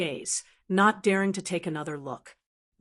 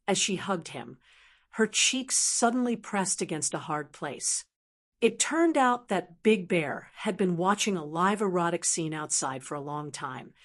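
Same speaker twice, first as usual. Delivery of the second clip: ordinary delivery, nothing marked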